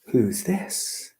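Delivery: plain reading voice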